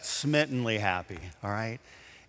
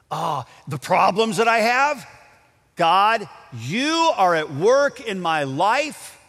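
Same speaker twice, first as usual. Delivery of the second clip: louder and higher, addressing God with passion; fervent prayer-like declaration; 5 semitones higher, 7 dB louder than usual. Acted. Pastor voicing a believer's prayer about problems, fervent. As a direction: cry it out to God as testimony.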